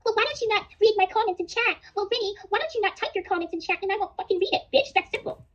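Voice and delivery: high-pitched mocking back-and-forth; mocking retort. Acts out a sarcastic back-and-forth with Vinny.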